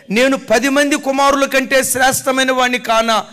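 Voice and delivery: loud, high, driving sermon; preaching at full force. Acted. Preacher driving home the husband's words, loud and high. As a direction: hammer the point home to the crowd.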